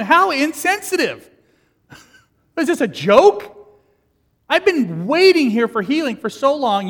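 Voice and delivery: high, loud, outraged whine; indignant complaint. Pretend. Crippled man protesting to Jesus, loud mock outrage. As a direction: play him offended at the nerve of it.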